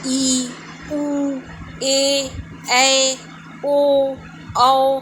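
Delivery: each vowel held out long and level, with a pause after it; drawn-out, evenly held vowels. Shown as a demonstration. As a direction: sustain ई through औ one at a time. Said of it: "इसमें ज़्यादा समय लगा".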